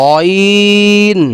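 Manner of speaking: অইন drawn out long from the throat's end; throat letter demo. Shown as a demonstration. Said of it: "যেমন কি?".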